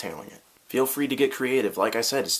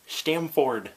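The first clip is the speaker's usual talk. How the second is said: higher Canadian-accent "Stamford" with a full "ford"; 6 semitones higher than usual. Shown as a demonstration. Vlogger testing a Canadian accent on the town name. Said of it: "get the Canadian accent going on there".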